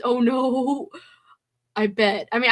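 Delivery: ordinary talk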